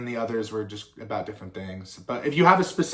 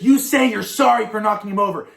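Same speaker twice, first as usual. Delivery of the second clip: loud, high scolding command; bossy big sister; 9 semitones higher, 10 dB louder than usual. Retold or imitated. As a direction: make him apologize, high and loud. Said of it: "she's like, she's like".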